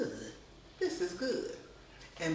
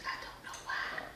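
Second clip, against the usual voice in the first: whisper, amused at yourself; 10 dB quieter than usual. Real, not acted. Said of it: "Every time I start whispering".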